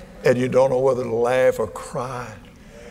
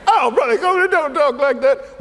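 high, scandalized little squeal; hammed-up shocked church lady; mock pearl-clutching, pitched high; mock prim churchgoer; 12 semitones higher, 4 dB louder than usual.

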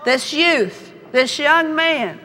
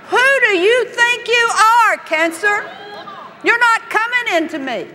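defiant rebuke; 9 semitones higher than usual. Acted. Rebuking cancer.

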